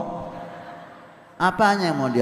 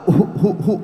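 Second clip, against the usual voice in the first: mock cough; 3 semitones higher, 4 dB louder, faster than usual.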